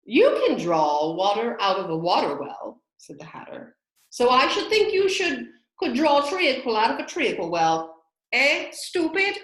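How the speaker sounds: loud, high, mocking Hatter. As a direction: sneer at her loudly.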